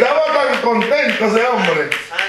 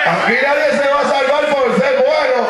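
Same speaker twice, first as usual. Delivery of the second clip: preaching at full force; 7 semitones higher than usual. Acted. Pastor exhorting the church, preaching at full force. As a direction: preach it hard to the congregation.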